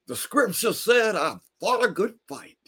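high preaching cadence; preaching old man. Retold or imitated. Neighbor preaches to Carlos, high sermon voice. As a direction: quote scripture like a sermon.

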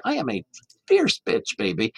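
sassy drag queen. Shown as a demonstration. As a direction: snap your fingers and own it like a drag queen. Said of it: "you've gotta snap and say".

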